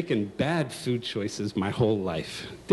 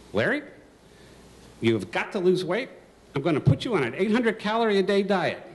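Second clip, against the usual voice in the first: higher, firm mother's order; 8 semitones higher than usual. Retold or imitated. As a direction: lay down the law like a worried mom.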